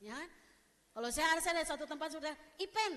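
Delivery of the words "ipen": high, loud call of IPEN; eastern-style call. Acted. Someone from Ambon or Papua spotting her, calling out. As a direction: hail her as IPEN from afar. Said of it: "ini pasti kok orang Ambon".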